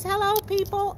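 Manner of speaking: lightly voiced cat greeting; a hint of a high cat voice. Preacher voicing the cat greeting viewers, lightly voiced. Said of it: "she says".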